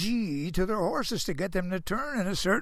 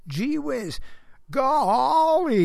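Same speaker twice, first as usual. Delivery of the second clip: high, loud hayseed "Gee whiz. Golly"; a touch of a Southern gomer, pitched up; Gomer Pyle; 7 semitones higher, 4 dB louder, much slower than usual. Retold or imitated. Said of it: "I sound like Gomer Pyle".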